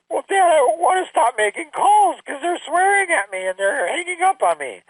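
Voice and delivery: put-on high, whimpering kid voice; whiny daughter. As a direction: mimic the daughter pleading to quit. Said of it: "what do you wanna do".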